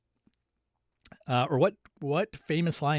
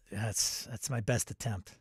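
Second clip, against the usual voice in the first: usual speaking voice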